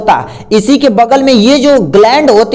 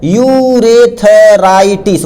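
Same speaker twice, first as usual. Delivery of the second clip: very slow, each syllable of urethritis drawn out for notes; slow dictation; much slower than usual. Shown as a demonstration. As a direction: spell out urethritis slowly so students can write it. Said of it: "क्या बोलते हैं".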